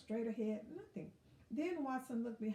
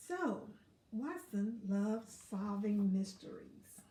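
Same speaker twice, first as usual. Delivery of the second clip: plain reading voice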